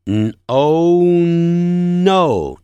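hummed 嗯, long oh, blended into no; drawn-out N in no. Shown as a demonstration. Teacher showing no begins with a nasal N, drawn out. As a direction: stretch the N before each no. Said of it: "好，同样的".

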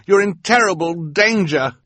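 high, urgent warning; lightly voiced boy warning; warning Klaus. A narrator's reading. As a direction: sound the alarm. Klaus warns.

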